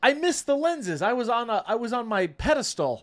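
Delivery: surprised. Real, not acted. Sudden shock at missed lenses.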